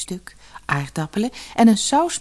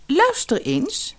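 hoog en langzaam gebiedend; bazige vrouw; 11 semitones higher, slower than usual. Acted. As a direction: beveel als een koningin. Vrouw als koningin. (gebiedende vrouw)

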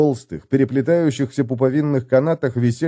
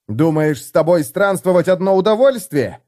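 louder, higher furious shout; angry yell; 7 semitones higher, 3 dB louder than usual. A narrator's reading. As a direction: yell it in his face. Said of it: "заорал разозлившийся Феликс". (Феликс в ярости орёт на Готрека)

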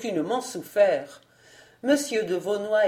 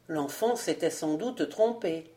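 ordinary delivery, nothing marked

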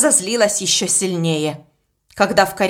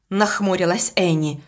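ordinary delivery, nothing marked

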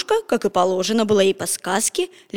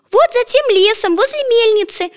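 put-on high, bright little girl calling out; cheerful girl; 12 semitones higher, 5 dB louder than usual. A narrator's reading. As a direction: chirp out the directions. Girl points the way.

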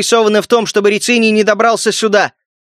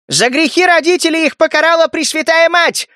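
high-pitched outcry from the crowd; pious heckler; 10 semitones higher than usual. A narrator's reading. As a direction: cry out an accusation in a high put-on voice. (высокий обличающий выкрик из толпы)

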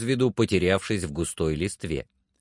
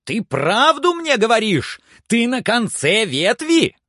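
very high, loud questions; excited shouting; 12 semitones higher, 6 dB louder than usual. A narrator's reading. Legrand presses servant for the truth, high loud cry. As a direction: shout up in eager disbelief. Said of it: "вскричал Легран".

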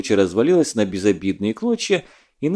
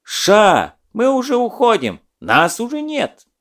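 nervous retreat; 10 semitones higher than usual. Acted. Zadov hastily retreating.

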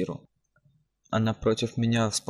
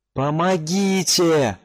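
far-off, slow, high cry; distant cry for help; 8 semitones higher, 7 dB louder, much slower than usual. Acted. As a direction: call for help from far away. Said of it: "кричал кто-то вдалеке".

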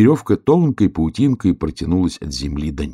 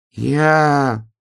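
drawn-out, higher hare answer; raised but restrained little voice; somewhat louder; 9 semitones higher, 3 dB louder than usual. A narrator's reading. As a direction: hold the word and project cautiously. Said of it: "погромче сказал заяц".

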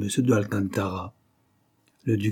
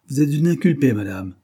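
louder, higher, stern reprimand; rough-voiced rebuke; 5 semitones higher, 6 dB louder than usual. A narrator's reading. Irritated judge to the insolent suspect, harsh. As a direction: put the suspect sternly in place. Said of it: "la voix rude".

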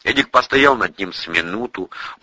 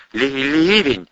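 stammering guess; 6 semitones higher, slower than usual. A narrator's reading. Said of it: "высказал догадку армеец".

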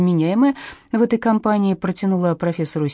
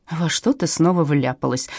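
ordinary delivery, nothing marked

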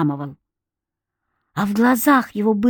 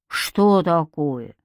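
mildly surprised; 4 semitones lower, much slower than usual. A narrator's reading. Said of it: "Князь-медведь удивился".

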